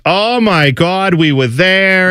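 lightly playing the aunt, stretching the vowels; slow mock Jersey accent; Aunt Gina's Jersey accent. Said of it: "it's just".

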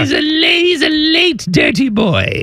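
very high cartoonish voice; silly teasing voice. Host ribbing a South African golfer, silly high voice. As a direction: tease in a squeaky put-on voice.

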